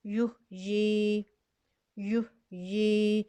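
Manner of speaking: युहयी very slow, the long "ee" kept; recited word. Shown as a demonstration. Teacher recites the word slowly. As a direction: hold the final ee of युहयी. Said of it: "ऐसे ही पढ़ा जाएगा".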